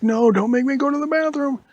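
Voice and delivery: high, loud whiny plea; whiny scared boy. Acted. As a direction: beg like a kid who won't go. Boy begging not to go.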